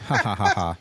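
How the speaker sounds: spoken-out fake "ha ha"; mock laugh. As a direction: laugh it off with separate, forced ha's. Retelling his disbelieving fake laugh.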